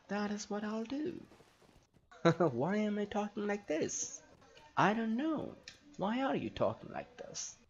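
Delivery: high, playful mock-elf voice; Nembadeth. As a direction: put on a high, stilted fantasy-elf lilt. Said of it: "I'm trying to talk like Nimbadeath now".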